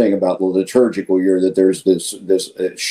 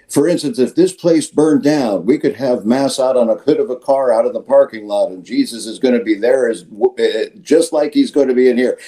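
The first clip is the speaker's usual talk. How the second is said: higher, preaching homily voice; quoting his homily; 4 semitones higher than usual. Retold or imitated. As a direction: echo a line from his own sermon, pitched up. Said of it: "I'm sure you've heard me say from the pulpit".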